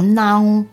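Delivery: "naon" with a long nasal vowel; nasalized vowel. Shown as a demonstration. Presenter gives an example of the tilde, nasalized vowel. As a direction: draw "naon" out slowly through the nose. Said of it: "Les voyelles nasales sont matérialisées par le tilde".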